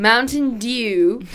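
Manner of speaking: slow, loud, stretched-out English "dew"; drawn-out British newsreader announcing Mountain Dew; announcer-like. Shown as a demonstration. English podcaster trying out saying dew, announcer-like. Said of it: "You sound like you're announcing the war".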